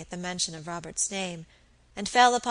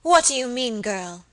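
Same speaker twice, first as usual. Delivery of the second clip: louder, sharp fierce demand; 3 semitones higher, 7 dB louder than usual. Acted. Lady Audley rounding on her maid, fierce demand. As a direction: snap the question fiercely. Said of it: "cried my lady fiercely".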